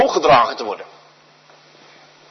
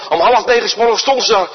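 ordinary talk

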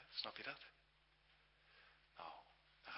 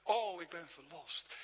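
joyful cry; 5 semitones higher, 4 dB louder than usual. Retold or imitated. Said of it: "riep hij uit".

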